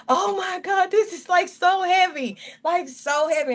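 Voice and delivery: reliving the weight hitting her while reading; retold grieving cry. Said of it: "I was just grieving".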